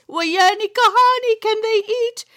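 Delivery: amazed exclamation. Acted. Grandma marveling to her grandchild about hungry priests, amazed. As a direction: exclaim it in delighted disbelief.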